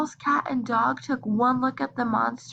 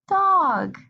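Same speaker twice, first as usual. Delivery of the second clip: big reveal; 8 semitones higher, 6 dB louder than usual. A narrator's reading.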